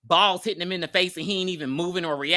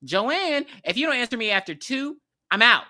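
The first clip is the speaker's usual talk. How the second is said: calling out; 6 semitones higher than usual. Retold or imitated. Kyle calling out for Joanne.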